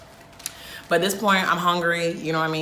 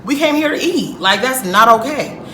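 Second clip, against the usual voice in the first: louder, heated scolding of the server; angry telling off; 3 semitones higher, 7 dB louder than usual. Retold or imitated. Hungry customer scolding the server, loud and heated. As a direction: tear into her for forgetting the order. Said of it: "I'm very upset".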